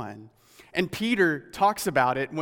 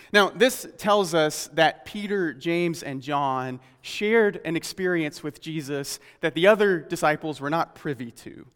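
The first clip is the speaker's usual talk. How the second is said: ordinary talk, nothing marked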